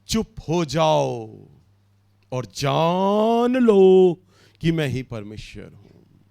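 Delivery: the verse read slowly, with long pauses; चुप हो जाओ spoken slowly and solemnly, with pauses between phrases. Shown as a demonstration. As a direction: weigh each word of the scripture. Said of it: "ओ, प्रभु इस बात को यूं ही शायद बोल रहे हैं".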